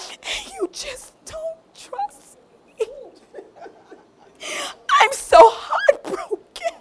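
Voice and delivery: high, slow mock sobbing; crocodile tears. Delilah fake-crying to guilt Samson, high and teary. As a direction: ham up the fake heartbreak. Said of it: "crocodile tears".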